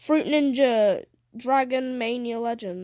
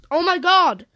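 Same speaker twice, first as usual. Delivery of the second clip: frustrated outburst, loud and high; raging gamer; 6 semitones higher, 7 dB louder than usual. Real, not acted. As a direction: blow up at the screen. Game still not loading.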